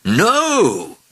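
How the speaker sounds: high, emphatic royal no; emphatic refusal. A narrator's reading. King refuses mayor's offer.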